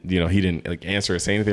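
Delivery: ordinary talk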